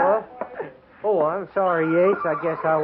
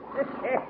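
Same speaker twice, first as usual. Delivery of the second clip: short amused laugh; a teasing chuckle; 8 semitones higher, 6 dB quieter than usual. Acted. Listener laughs at Yates.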